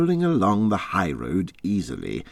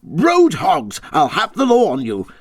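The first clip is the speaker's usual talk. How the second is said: furious tirade; 13 semitones higher, 6 dB louder than usual. Acted. Rat raging at the reckless motorists, loud and high. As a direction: shake your fists and rant. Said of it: "shaking both fists".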